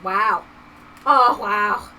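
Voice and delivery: put-on loud British-accented catchphrase; British cousin. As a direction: boom out a mock-British wow. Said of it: "my cousin who Kathy knows as".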